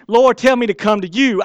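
mock-earnest loud plea. Preacher imitating Peter asking Jesus, loud plea. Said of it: "I wouldn't be".

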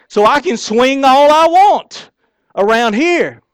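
loud, high preacher's cry; preaching shout. Real, not acted. Preacher shouting to the church about personal reach. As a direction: belt it out to the congregation.

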